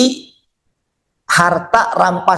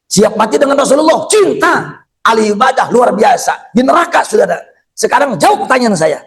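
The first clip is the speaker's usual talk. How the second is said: high-pitched, indignant sermon; punchy preacher's outcry, pitched high; fervent preaching; 6 semitones higher than usual. Real, not acted. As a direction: rail at the congregation about betrayal.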